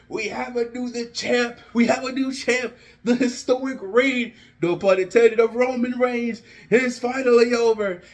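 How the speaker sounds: fast, excited ringside call of the match; live play-by-play. Acted. Fan narrating his dream match as an excited play-by-play. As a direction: call every move like a commentator at ringside. Fan calls imagined SummerSlam finish.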